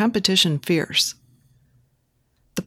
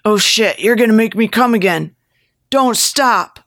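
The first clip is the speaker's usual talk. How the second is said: higher, louder, building plea; urgent excitement; 5 semitones higher, 5 dB louder than usual. A narrator's reading. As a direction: get carried away, pitch climbing to the end. Character's climactic urgent plea.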